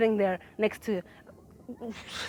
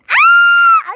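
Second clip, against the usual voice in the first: squeal; 15 dB louder than usual. Real, not acted. Sudden squeal.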